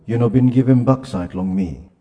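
ordinary talk

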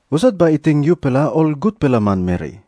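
usual speaking voice

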